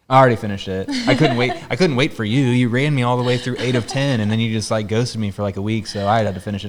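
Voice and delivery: a hint of a wife justifying herself; unapologetic wife. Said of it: "And she'll be like".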